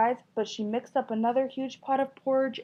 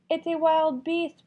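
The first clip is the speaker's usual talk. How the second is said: mock growl; 7 semitones higher, 5 dB louder than usual. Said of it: "Papa Bear growled".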